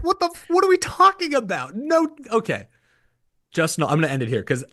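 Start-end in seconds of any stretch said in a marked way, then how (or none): 0.0-2.7 exasperated. Real, not acted. Exasperated outburst at own take.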